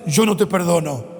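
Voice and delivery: low-pitched, mildly acted rebuff; acted refusal. Other person refusing a request for forgiveness, low and firm.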